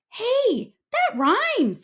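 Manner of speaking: high, bright discovery; a kid delighted by his own rhyme; excited cry. A narrator's reading. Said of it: "he cried".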